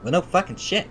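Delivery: ordinary talk, nothing marked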